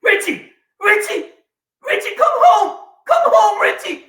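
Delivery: high, loud panicked calling; sister screaming. Retold or imitated. Sister on the phone begging him home, loud and high. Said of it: "It's my sister".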